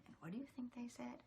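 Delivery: hushed, breathy cue; conspiratorial whispered prompt. Shown as a demonstration. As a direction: lean in and ask the kids in a whisper. Reader cues the refrain.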